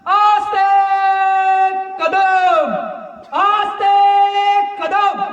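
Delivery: high, drawn-out ceremonial herald's shout; Shivgarjana war cry. Real, not acted. Orator rousing sleeping mavlas with Shivgarjana, slow roaring proclamation. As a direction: proclaim each title at full voice, stretching it out. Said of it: "एक शिवगर्जना करतो".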